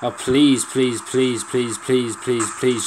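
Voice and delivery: rapid-fire begging, stressed then easing off; desperate pleading. Real, not acted. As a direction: plead over and over, exasperated. Begging game troops to attack.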